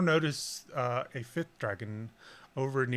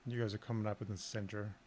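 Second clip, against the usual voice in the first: ordinary talk, nothing marked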